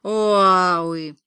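loud, drawn-out wail; parrot howl. A narrator's reading. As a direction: let out a long, sustained animal howl. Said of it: "вновь взвыл".

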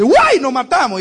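husband yelling high and loud in alarm; startled cry. Acted. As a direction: shout as a cow steps onto the road. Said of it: "el hombre dice". (el marido grita asustado al ver la vaca, fuerte y agudo)

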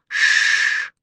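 long snake hiss; drawn-out voiceless "shhh". A narrator's reading. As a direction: hiss like a cobra. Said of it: "кто это шипит?".

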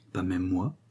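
understated innuendo; insinuating. A narrator's reading. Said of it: "dit Bénard d'une voix insinuante".

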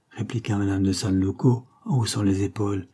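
ordinary delivery, nothing marked

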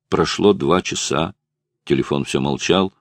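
ordinary delivery

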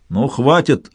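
usual speaking voice

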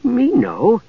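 high, sly creature voice; high-pitched, crafty little dwarf; cunning. Acted. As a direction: let on slyly that you know. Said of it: "he said cunningly".

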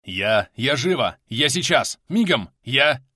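loud, climbing broken bursts; flustered urgency. A narrator's reading. Милован dashing about to help, flustered urgency. Frantic companion promising to hurry.

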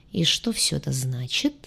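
low, soft purring bass; deep bass purr. A narrator's reading. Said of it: "промурлыкала она густым басом".

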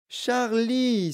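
high-pitched cry of delight; high, excited greeting; Wonka exclaiming. A narrator's reading. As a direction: exclaim the name as a thrilled host. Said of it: "s'exclama monsieur Wonka".

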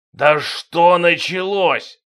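king roars impatiently at the sergeant; roaring impatiently. A narrator's reading. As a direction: bellow it out of impatience. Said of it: "взревел король от нетерпения".

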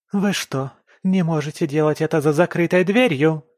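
high, loud squeal; shrill squeal. Acted. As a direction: screech it at them. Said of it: "верещит Ника".

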